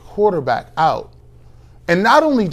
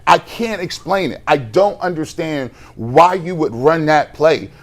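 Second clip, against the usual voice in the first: usual speaking voice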